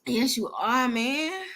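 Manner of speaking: ordinary delivery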